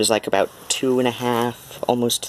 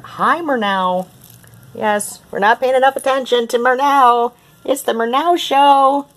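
very high, louder squeaky coo; 6 dB louder than usual. Real, not acted. Owner gushing squeakily at her cat Murnau.

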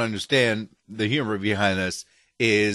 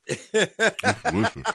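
slow, laughing, mouth full; 9 semitones higher, slower than usual. Real, not acted. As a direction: savour it through a bite. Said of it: "you got a mouthful of food".

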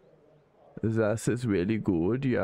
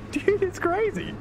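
excited. Real, not acted. Excited about engine sound.

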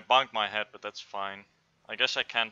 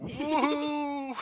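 excited whoop. Real, not acted. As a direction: whoop it out on the jump. Leaping again, cheering loudly.

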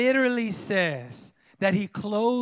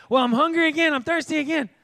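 high, whiny mock grumbling; frustrated complaining; 7 semitones higher, 4 dB louder, faster than usual. The people grumbling to Moses, pitched up in frustration. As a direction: complain like a fed-up crowd. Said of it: "the people are just getting frustrated".

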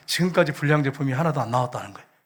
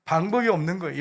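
ordinary talk

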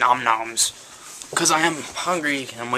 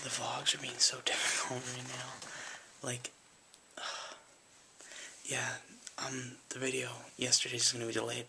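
late-night hushed whisper; 2 semitones lower, 19 dB quieter than usual. Real, not acted. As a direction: whisper so nobody wakes.

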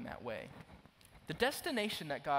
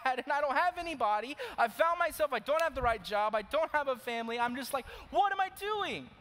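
high, loud, pleading outcry to God; anguished lament; 13 semitones higher, 8 dB louder than usual. Acted. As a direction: cry out in despair at full force. Anguished self-questioning opening monologue.

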